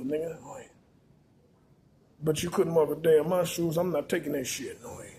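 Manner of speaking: ordinary delivery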